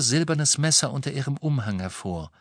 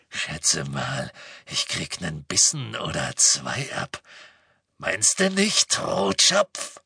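low predatory voice, lurching up on the taunt; leering villain coo; cooing menace; 6 semitones lower, slower than usual. A narrator's reading. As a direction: taunt the captive in a creepy croon. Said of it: "gurrte Greyback".

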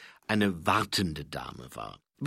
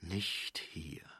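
very soft, near-whisper; inner thought; 3 semitones lower, 10 dB quieter than usual. A narrator's reading. Angua thinking to herself, hushed. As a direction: breathe it quietly to yourself. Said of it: "dachte sie".

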